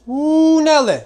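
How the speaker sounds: high, loud whoop; excited exclamation. Real, not acted. Exclaims at the food.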